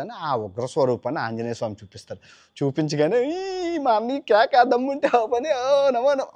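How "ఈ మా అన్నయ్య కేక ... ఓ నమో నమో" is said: high, slow, awestruck cheering; thrilled cheer. Acted. Bhima cheers his brother's fierce form in a high, awed voice. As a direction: cheer your big brother in awe.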